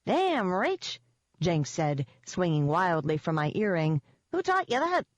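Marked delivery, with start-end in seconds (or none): 0.0-1.0 high pixie voice, drawn out; high-pitched impressed pixie; pixie sidekick. A narrator's reading. Pixie to the narrator from her earring, high and impressed. 4.3-5.0 much higher pixie voice; high, astonished little pixie question; pixie sidekick. A narrator's reading. Pixie asking the narrator about her move, high and astonished.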